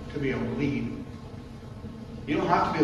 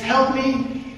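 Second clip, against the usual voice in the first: higher, louder little plea for help; pleading snake; 10 semitones higher, 5 dB louder than usual. Acted. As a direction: beg like a trapped snake. Snake pleads in told story.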